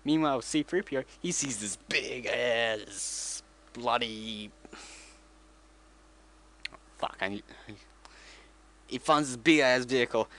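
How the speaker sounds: usual speaking voice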